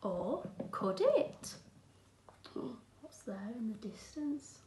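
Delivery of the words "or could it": ordinary delivery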